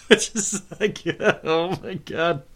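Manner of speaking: cracked up at your own impression; helpless laugh breaking into the words. Real, not acted. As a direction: talk through real laughter.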